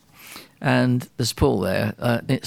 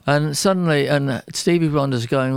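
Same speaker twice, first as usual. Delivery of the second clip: normal talking voice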